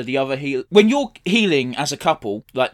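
ordinary talk

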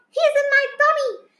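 high, loud, fast exclamation; excited cry. A narrator's reading. Child telling Mummy who spoke, excited cry. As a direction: blurt it out in triumph. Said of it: "I cried".

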